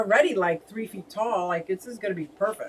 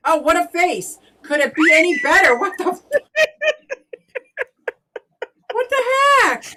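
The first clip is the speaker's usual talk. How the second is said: high, loud shocked squeals breaking into laughter; real delight bursting out at the screen; shrieking excitement; 9 semitones higher, 9 dB louder than usual. Real, not acted. To her viewers over her frozen stream face, high shocked squeal.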